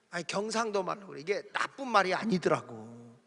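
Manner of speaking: ordinary delivery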